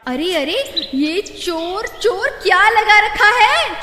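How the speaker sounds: high woman's voice rising to an outraged shout; a wife snapping back, offended; indignant village woman. Acted.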